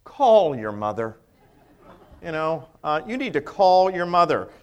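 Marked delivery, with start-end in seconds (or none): 0.0-1.2 imitated parental reminder. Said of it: "I have to call my boys and say".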